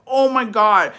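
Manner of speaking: mock disgusted outburst; grossed out. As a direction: gag and accuse. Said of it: "I was like".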